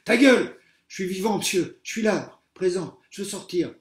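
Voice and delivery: loud, high furious yell; trapped pupil shouting at the joker and the teacher; panicked shout. A narrator's reading. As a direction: scream back in panic. Pupil yelling in the rubble.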